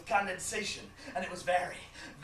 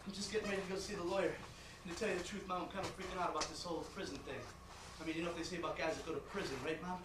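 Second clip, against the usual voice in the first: quick, soft, low murmur; hushed, hurried phone confession; nervous confiding; 4 semitones lower, 10 dB quieter, faster than usual. Acted. Son nervously confides in mother.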